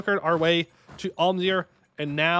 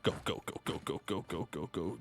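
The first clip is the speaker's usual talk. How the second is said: soft, rapid go go go under the breath; hushed urging; 9 semitones lower, 12 dB quieter than usual. Real, not acted. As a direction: egg the troops on almost silently.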